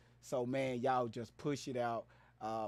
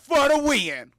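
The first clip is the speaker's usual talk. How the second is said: loud, high hype-man yell; hype intro shout; 11 semitones higher, 13 dB louder, slower than usual. Acted. Streamer hyping his own entrance over sound effects, shouting. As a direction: announce yourself like a ring entrance. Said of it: "There go my sound effects".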